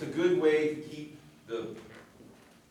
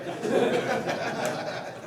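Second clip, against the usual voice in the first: high laugh; 11 semitones higher, 6 dB louder than usual. Real, not acted. Laughs at demo.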